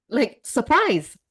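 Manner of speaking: ordinary talk, nothing marked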